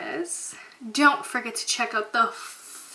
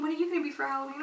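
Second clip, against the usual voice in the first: ordinary delivery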